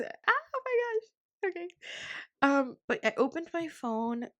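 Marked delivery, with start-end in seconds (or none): 0.0-1.7 overwhelmed squeal. Real, not acted. Podcaster moved by inspired friend, squealing. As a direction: let it rise into a long squeal.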